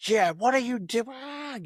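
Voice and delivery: high, slow, whiny kid voice imitating a child; upset kids. Kids upset about the hurt butterfly, imitated in a high, slow child's voice. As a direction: stretch it out like a shocked little kid. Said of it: "they're like".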